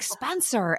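high, soft scandalised call; mock reproach. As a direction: chide a child by name, quietly. Mum reproving son for swearing.

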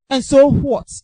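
defiant shout. Acted. Preacher to discouraged listeners, loud defiant challenge. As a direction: belt out "And so what?" loud and sharp, falling hard at the end.

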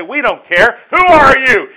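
loud, high scornful retort; defiant people. Pretend. Preacher voicing rebellious people answering God, loud scornful defiance. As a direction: toss it back with contempt. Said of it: "And you say".

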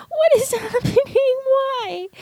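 very high, tearful retold wail; overwhelmed crying. Daughter overwhelmed by news about her father, tearful wail. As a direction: squeal it up high as if breaking down.

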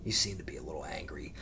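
whispered aside. Acted. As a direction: lean in and whisper it. Aside during heckler story.

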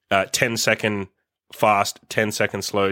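normal talking voice

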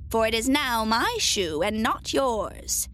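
slow, taunting witch voice; retorting witch. A narrator's reading. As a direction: draw it out possessively, like a wicked witch. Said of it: "retorted the witch".

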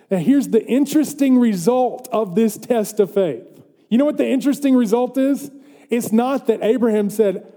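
animated pulpit voice; preaching. Real, not acted. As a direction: preach it with rising energy. Preaches with raised voice.

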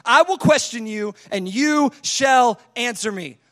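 loud, deliberate command; forceful, slowing to a stern finish; God going hard. Acted. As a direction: lay down the law to Job. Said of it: "he just goes hard on him".

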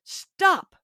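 put-on a sharp high call of "Stop" to a child in the street; urgent cry of stop. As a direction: snap the word "Stop" out high to halt the child. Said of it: "I cry out".

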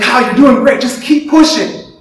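mock coach urging him on; encouraging coach. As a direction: cheer him on like a coach, a bit hammed. Imitating Joel's encouragement.

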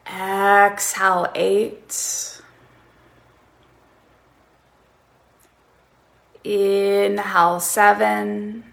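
calm, drawn-out breath counts; slow breath counting. Shown as a demonstration. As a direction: count each breath slowly. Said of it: "So counting in this way".

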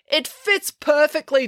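high, slow, emphatic claim; confident declaration. A narrator's reading. April defends her theory to Mister Lang, emphatic declaration. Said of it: "declared April".

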